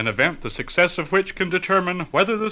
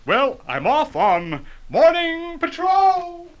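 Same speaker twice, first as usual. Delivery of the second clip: heroic superhero voice; 10 semitones higher, slower than usual. Acted. Superhero signs off.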